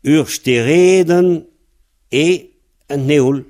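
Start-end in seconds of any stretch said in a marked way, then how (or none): 0.0-1.4 Ur, stereden slow and raised, stereden stressed on the long E; slow modelling. Shown as a demonstration. Teacher models the reversed phrase for the student, raised and slow. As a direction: say it slowly and clearly for a learner. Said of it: "par l'autre bout de la phrase".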